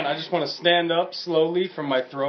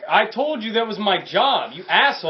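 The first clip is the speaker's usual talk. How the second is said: higher-pitched exasperated complaint; indignant outburst; 4 semitones higher, 3 dB louder than usual. Acted. Player's character berates the dude over his job, indignant. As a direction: tell him off, aggrieved and incredulous.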